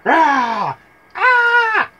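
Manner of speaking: loud, high mock screams; hammed-up girl's scream.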